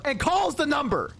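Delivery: ordinary talk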